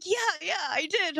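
put-on high, slow little kid agreeing proudly; child's proud yes; smug child voice. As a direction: play the satisfied kid. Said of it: "He's just like".

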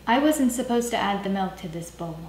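normal talking voice

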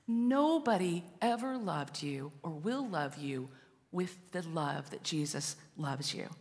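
ordinary talk, nothing marked

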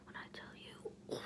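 hushed emphatic whisper. Real, not acted. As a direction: breathe it dramatically.

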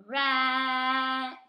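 Rack drawn out long and loud, sounds sung together; teacher modelling sounds slowly; stretched sounds. Shown as a demonstration. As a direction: sing out Rack slowly, each sound held. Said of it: "say a word very slowly so you can hear each sound".

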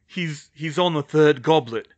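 loud, faltering heavy report; thick, leaden. A narrator's reading. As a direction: stumble heavily into the news. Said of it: "his voice thick and leaden".

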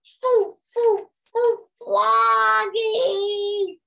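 put-on high, loud slow baby wail; baby wailing for Froggy. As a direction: wail for her brother. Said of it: "wailed Polly".

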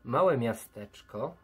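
slow dramatic narration. A narrator's reading. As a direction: paint the ruined town slowly.

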